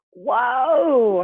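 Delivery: loud surprised whoa; startled whoa. Acted. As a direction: jump back at the sight. Bunny startled by machine egg.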